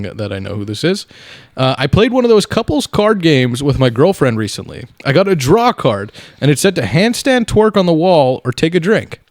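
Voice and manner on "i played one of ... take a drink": reading aloud. Acted. Reads listener submission aloud.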